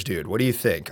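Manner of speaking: ordinary talk, nothing marked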